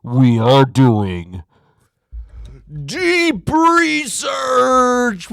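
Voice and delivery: booming slow movie-trailer announcer; big slow trailer voice; hype announcer. Acted. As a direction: roll it out like a hype intro. Hyped intro announcement.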